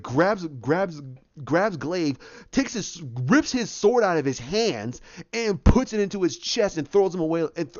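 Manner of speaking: usual speaking voice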